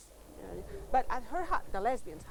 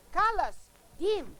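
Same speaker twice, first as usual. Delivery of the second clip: put-on higher-pitched call to the kids; 7 semitones higher, slower than usual. Mother to kids near the neighbor's garden, calling out. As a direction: call their names, pitch jumping up.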